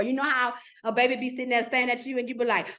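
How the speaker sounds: ordinary talk, nothing marked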